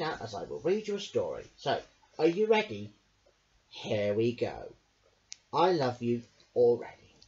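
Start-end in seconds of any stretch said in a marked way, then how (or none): none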